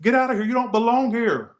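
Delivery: high mocking yell of the strangers; mock yell from passing car. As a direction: imitate strangers yelling out the window. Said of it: "I heard these Spanish people say".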